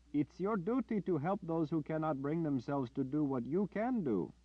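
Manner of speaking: high, pressing voice; insistent villager. Acted. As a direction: press him on his duty. Villager demands his duty.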